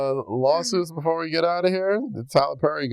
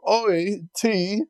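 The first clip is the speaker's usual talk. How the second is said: high-pitched mock British accent; hammed-up British "oi"; mock Peaky Blinders accent; 7 semitones higher than usual. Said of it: "trying to do a Peaky Blinders".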